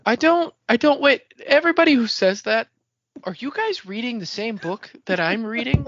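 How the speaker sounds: high, loud, incredulous protest; indignant outburst. Real, not acted. Cohost rants at listeners who call the Spook hate random, high and indignant. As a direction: defend the book in disbelief.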